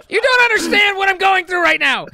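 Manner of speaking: high, loud shriek through laughter; hysterical protest. Real, not acted. Gamer explaining the ordeal to friends, laughing shriek. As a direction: cry out as if pushed to the limit.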